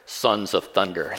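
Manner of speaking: "Sons of Thunder" dropped low and drawn out slowly; low, slow, rumbling. Shown as a demonstration. Preacher to congregation, "Sons of Thunder" low and slow.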